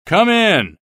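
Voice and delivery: high, loud challenge; the American challenges the invisible man at the door; brave demand. Acted. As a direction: dare the invisible foe to enter. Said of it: "demanded the American bravely".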